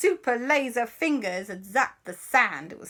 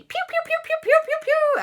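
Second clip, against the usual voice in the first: high squeaky rapid shots; laser zaps; 4 dB louder than usual. Acted. Auntie joins in with her phone, squeaky zapping.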